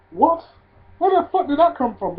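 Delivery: high, loud retold disbelief; incredulous outburst. Fan retelling his reaction to a promo, high incredulous outburst. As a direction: react as if totally blindsided, pitch way up.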